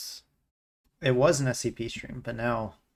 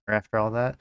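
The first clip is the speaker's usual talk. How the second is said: ordinary delivery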